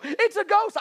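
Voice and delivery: high, hammed-up ghost-scare yelp; mock terrified cry. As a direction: squeal like a spooked fisherman. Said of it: "for them to go".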